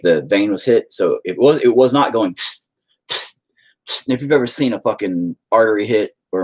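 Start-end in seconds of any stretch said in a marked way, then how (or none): 2.3-4.1 short sharp hissed spurts; imitated blood spurt. Showing how artery blood shoots, short hissed spurts. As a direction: spit out little bursts of hiss.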